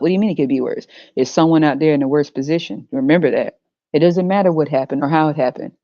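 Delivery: ordinary talk, nothing marked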